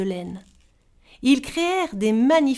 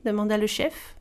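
ordinary delivery

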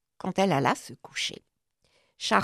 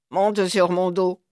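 ordinary delivery, nothing marked

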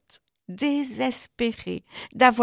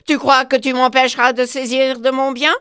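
fast, loud angry boast; furious panther; 4 semitones higher, 8 dB louder, faster than usual. Acted. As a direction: claim the whole forest in a rage. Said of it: "s'écria la panthère furieuse".

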